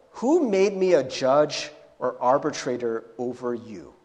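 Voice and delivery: curt, annoyed rebuke; low, annoyed put-down; annoyed Jesus. Acted. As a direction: brush him off, irritated. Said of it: "doesn't Jesus kinda sound annoyed".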